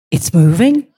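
rising, questioning double-take; stunned question in a retold moment; disbelieving question. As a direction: ask it in disbelief, lifting at the end. Retold doubting repeat, rising.

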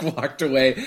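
ordinary talk

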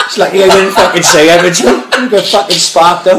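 can't stop chuckling at the near miss; laughing. Real, not acted.

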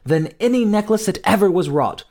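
high, exalted queenly voice; rapturous cry. A narrator's reading. Queen praising the baby in her arms, high rapture. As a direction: proclaim adoration in a high voice. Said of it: "she cried".

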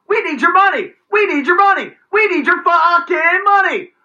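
hammed-up official waving everyone in for cash; mocking greedy official. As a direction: lampoon a greedy border officer.

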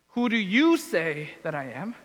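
YOU lifted and leaned on, ending as a question; stress on YOU. Shown as a demonstration. Preacher quoting Jesus turning the question to disciples, stress on YOU. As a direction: punch "you" as the pointed follow-up question. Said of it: "The emphasis is on the you".

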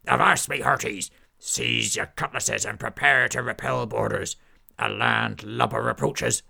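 loud commanding pirate warning; pirate captain alarm. Acted. Pirate captain warning crew of the knight, loud. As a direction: call the crew to arms.

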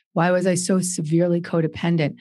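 ordinary talk

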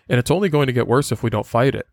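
ordinary delivery

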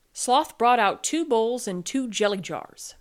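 ordinary delivery, nothing marked

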